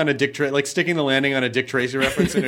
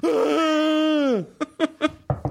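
mock shocked gasp; 11 semitones higher than usual. Friend revealed in driveway.

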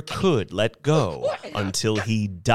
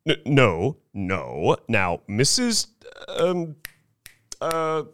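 flustered stalling; 2 semitones higher than usual. A narrator's reading. Salesman flustered, forgets her name.

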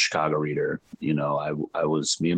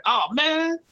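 much higher put-on voice for the host's catchphrase; impression of the host. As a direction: ham up the host saying oh man. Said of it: "I was you".

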